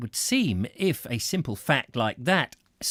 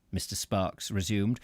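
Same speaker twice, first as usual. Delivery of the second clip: ordinary delivery, nothing marked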